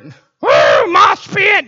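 high, loud whoop and yell; rowdy crowd cheer; concert-goer shout. Acted.

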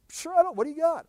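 high, casual visitor voice. Pretend. Visitor answering Abraham's offer, high and casual. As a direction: toss it off like a hungry guest.

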